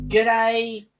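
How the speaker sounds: G'day in an Australian accent; Aussie greeting. Shown as a demonstration. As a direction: demonstrate a drawn-out Aussie hello. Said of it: "in Aussie".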